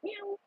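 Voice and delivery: high, soft mock meow; imitated cat meow. Telling viewers what the cat says, a high, soft meow. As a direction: copy the cat's meow for the viewers. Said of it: "She's like".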